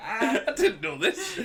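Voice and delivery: laughing. Real, not acted. Host hearing of the headbutt, laughing disbelief. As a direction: laugh it out, caught off guard.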